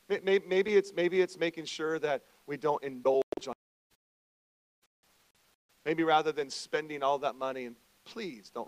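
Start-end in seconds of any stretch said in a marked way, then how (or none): none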